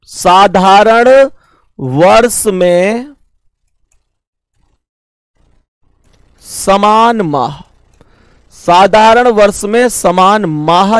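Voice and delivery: slow, word by word, as if writing on the board; drawn-out classroom dictation. Shown as a demonstration. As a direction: dictate the heading slowly. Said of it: "मैं heading लिखता हूं".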